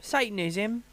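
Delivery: put-on South London vowels; South London accent. Shown as a demonstration. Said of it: "South London".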